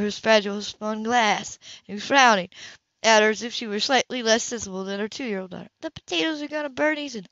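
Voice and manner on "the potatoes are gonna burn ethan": higher, dismissive; Grace brushing it off. A narrator's reading. Grace to Ethan, higher and brushing it off. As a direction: wave it away lightly.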